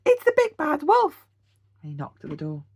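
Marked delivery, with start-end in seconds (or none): 0.1-1.3 frightened cry. A narrator's reading. Horace spots the wolf's eyes, crying out in fright. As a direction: gasp it out in fright. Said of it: "cried Horace".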